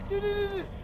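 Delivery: rapid high panicked repeats. Real, not acted. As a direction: blurt it out in fear. Panicking at monster.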